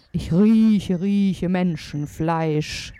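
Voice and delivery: loud, very slow giant voice; drawn-out storybook giant's roar; giant calling out. A narrator's reading. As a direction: bellow it slowly, stretching each word. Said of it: "rief der Riese".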